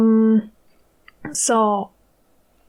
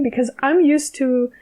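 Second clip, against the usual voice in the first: normal talking voice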